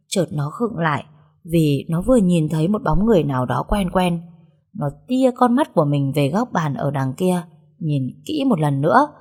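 ordinary delivery

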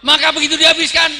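high, booming preacher's shout; sudden shout. Real, not acted. Preacher jolts the listeners mid-story, sudden shout. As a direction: bellow it out at full force.

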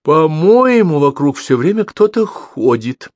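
high, loud irritated mother. A narrator's reading. Irritated mother in the dark, high and loud. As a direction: grumble like an annoyed mother. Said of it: "раздражённый голос сказал".

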